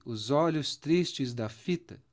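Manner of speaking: "fita" with its last syllable "ta" nearly swallowed, said slowly; weak final syllable. Shown as a demonstration. Teacher shows students how the weak end of "fita" fades, slow. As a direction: read "os olhos tristes da fita" slowly and let the "ta" of "fita" almost vanish. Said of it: "vocês percebem que o tá do fita quase some".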